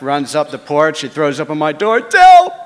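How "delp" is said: much higher and louder, yelling for help; frantic screaming. Retold or imitated. Drunk Jeff screaming to Delp for a gun, frantic yell. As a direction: scream it in panic and rage. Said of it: "Jeff screaming at the top of his lungs".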